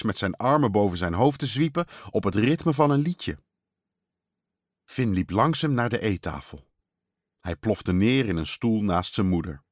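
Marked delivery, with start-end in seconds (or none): none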